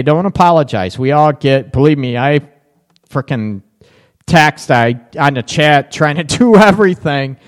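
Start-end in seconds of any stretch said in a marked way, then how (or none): none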